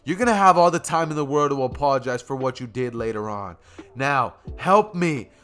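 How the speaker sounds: loud, urgent acted shouting; shouted command. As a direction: call out orders over a battle. Bakugo shouting orders in a fight.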